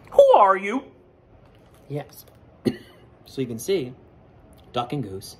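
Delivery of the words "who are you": a high, loud, questioning voice; high character voice. Acted. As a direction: ask it like a puzzled bird. Character voice in story.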